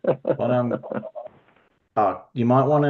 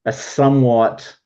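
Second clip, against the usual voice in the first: usual speaking voice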